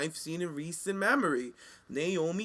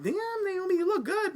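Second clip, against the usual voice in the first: way up high, gushing; impressed squeal. Retold or imitated. Fan reacting to Naomi's return, gushing high. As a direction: swoon over her in a high pitch. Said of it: "I'm like".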